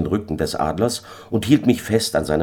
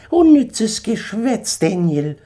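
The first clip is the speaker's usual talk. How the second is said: scornful eagle; slower than usual. Acted. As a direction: sneer down at the drunk. Eagle figure held voice.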